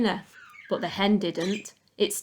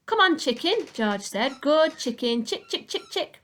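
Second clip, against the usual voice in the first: high, sing-song call to a chicken; coaxing the hen; 7 semitones higher than usual. Acted. Boy calling a hen over, high sing-song coaxing. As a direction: coax the hen closer, high and sweet.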